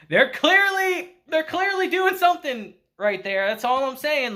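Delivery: teasing excitement. Real, not acted. Teasing viewers about a couple.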